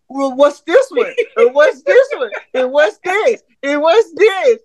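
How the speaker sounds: high mock voice of the wife, pestering; husband mimicking his wife's pestering questions. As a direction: squeak out her nagging questions in a hammed-up falsetto. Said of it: "She was like".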